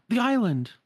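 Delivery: high, loud cry of alarm; calling out. Acted. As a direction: call out in dismay at what you see. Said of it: "And Sora calls out".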